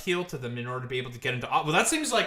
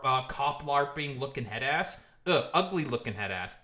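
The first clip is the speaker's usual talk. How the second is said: ordinary talk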